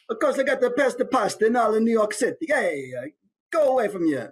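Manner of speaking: high, quick Italian chef patter; Italian chef accent. Shown as a demonstration. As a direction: brag like an Italian cook in New York. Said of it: "Maybe he's Italian chef".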